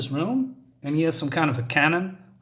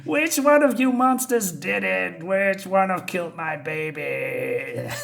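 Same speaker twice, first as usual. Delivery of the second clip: mock rage; 5 semitones higher, slower than usual. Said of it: "And he's raging".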